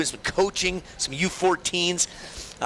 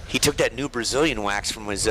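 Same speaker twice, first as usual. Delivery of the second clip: ordinary talk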